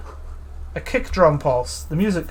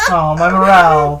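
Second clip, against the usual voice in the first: mock sob; 6 dB louder than usual. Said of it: "not so quietly crying".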